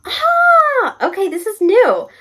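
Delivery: loud, high surprised ah; surprised shout. Real, not acted. Vlogger surprised by a new prompt, loud high exclamation. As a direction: cry out in surprise.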